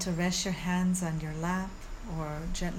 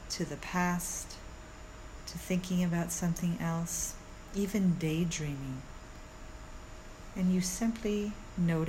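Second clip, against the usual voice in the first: soothing yoga teacher; 3 dB quieter than usual. Acted. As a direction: guide them calmly through the body. Leads a guided meditation.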